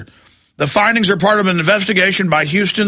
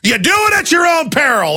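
rising angry rant, pitched much higher and shouting; real fury pouring out at listeners; getting mad; 4 semitones higher than usual. Real, not acted. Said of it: "All right, I'm getting mad".

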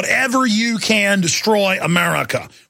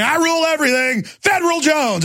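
mock boast; 8 semitones higher than usual. Walking around as Federal Jones, mock royal shout. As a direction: proclaim it like a king. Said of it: "walk around going".

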